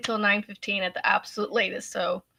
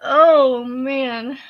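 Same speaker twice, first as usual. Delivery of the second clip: laugh-crying; 6 dB louder than usual. Real, not acted. Laughing tears.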